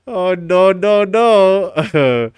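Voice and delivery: playful echo of a meme; meme imitation. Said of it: "I forgot all about that meme".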